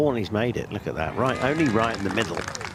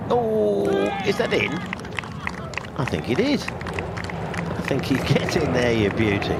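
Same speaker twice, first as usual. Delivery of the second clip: rising excitement as putt drops; thrilled call, laughing into it; excited; 5 semitones higher, slower than usual. Real, not acted.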